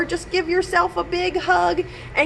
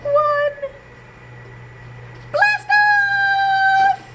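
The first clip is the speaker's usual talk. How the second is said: blast off shout; much slower than usual. Acted. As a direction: launch it with a big shout. Countdown blast off call.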